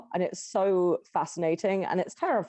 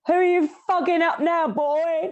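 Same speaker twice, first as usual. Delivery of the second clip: high, loud playful telling-off; mock scolding; 9 semitones higher, 6 dB louder than usual. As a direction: scold the boy in jest. Acted mum scolding boy.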